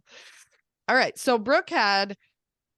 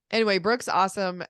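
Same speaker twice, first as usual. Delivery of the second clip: usual speaking voice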